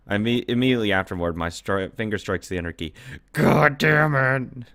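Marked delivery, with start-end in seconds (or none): 3.3-4.7 slow, high, loud real cry of anger; frustrated yell. Real, not acted. Gamer yelling at the game's cruel turn, drawn-out shout. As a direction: drag it out in furious despair. Player frustrated at game choice.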